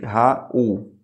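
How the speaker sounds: Raul slowly split as Ra-ul; hiatus split apart. Shown as a demonstration. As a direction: separate the a and u in Raul. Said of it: "Nem o I nem o U podem ter outra letra".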